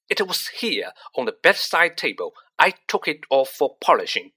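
shouting. Acted. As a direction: shout about the lost badge. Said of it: "Percy was shouting".

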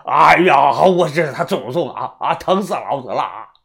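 loud wail of agony, then fading; pained cry. Acted. Sick old man crying out before fainting, in agony. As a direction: cry out in pain before giving way. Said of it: "这老头喊了一句啊".

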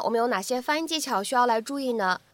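ordinary talk

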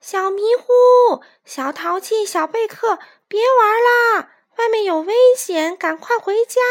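high, carrying, urgent call. A narrator's reading. Chicks helping call playmates home, calling out. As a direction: holler each name, stretching it. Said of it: "帮着呼喊".

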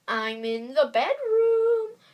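high, slow, wobbly fake granny; the wolf faking an old lady's quaver; strange wobbly voice. A narrator's reading. Wolf pretends to be Grandma, high and wobbly. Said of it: "in a strange, wobbly voice".

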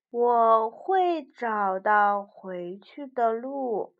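slow, steady bear. Acted. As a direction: say it slowly and calmly. Bear answers slowly.

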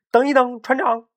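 high, loud urgent call; urgent shout. Acted. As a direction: stop him before it's too late. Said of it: "急中生智地喊道".

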